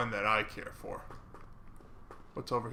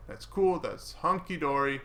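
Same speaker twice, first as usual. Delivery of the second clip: ordinary talk, nothing marked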